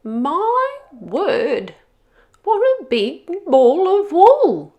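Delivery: put-on high, slow, surprised old granny. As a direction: peer at the wolf like a startled grandma. Granny's voice in the story.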